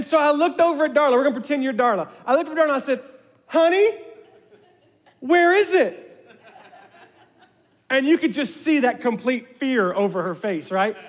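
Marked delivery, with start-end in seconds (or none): 3.5-6.0 high, loud teasing question; playfully corner wife; hammed-up accusation. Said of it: "I'm gonna ham this up".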